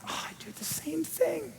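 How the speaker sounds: soft confessional whisper. Retold or imitated. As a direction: breathe it out like a secret admission. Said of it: "And I'm like".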